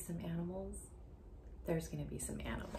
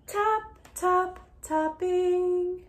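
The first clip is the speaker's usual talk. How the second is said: much higher, louder, slow tap, tap; tapping sound words; 13 semitones higher, 12 dB louder, much slower than usual. Acted. Teacher reading to a child, acting out the tapping. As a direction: tap it out crisp and bright.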